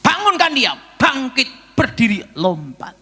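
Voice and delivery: loud, high commands, easing off at the end; forceful acted command from above; God's command. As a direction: voice a divine order to rise. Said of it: "Tuhan bicara sama saya".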